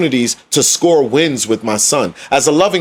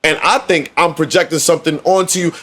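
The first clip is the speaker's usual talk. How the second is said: normal talking voice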